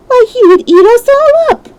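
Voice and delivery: very high, loud squeak of alarm; alarmed cry. A narrator's reading. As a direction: shriek in fright at the idea. Queen alarmed at the lion.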